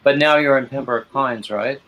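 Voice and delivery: ordinary delivery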